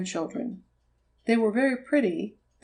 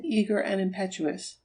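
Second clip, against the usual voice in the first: plain reading voice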